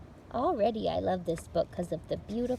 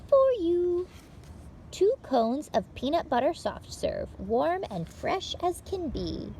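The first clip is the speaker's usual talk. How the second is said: bright, lively read-aloud for little listeners; picture-book reading; 4 semitones higher, 9 dB louder than usual. Acted. Librarian reading a picture book to children, lively read-aloud. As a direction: tell it like story time for small children.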